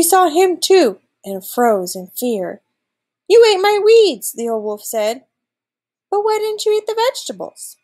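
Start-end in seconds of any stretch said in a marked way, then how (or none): 3.3-4.3 high, loud outburst; astonished exclamation. A narrator's reading. Old wolf to the weed-eating goat, astonished. 6.1-7.8 high, quick, puzzled voice; baffled question. A narrator's reading. Old wolf questioning the goat, baffled.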